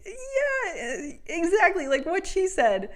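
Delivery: overeager agreement. Retold or imitated. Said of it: "I was just like".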